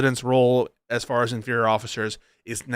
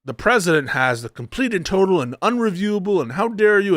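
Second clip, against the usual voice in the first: higher-pitched imitation of an outraged defender; lightly mocking, raised indignant voice; mock indignant pundit; 5 semitones higher than usual. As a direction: parody a scandalized commentator rattling off claims. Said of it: "it's gonna be like".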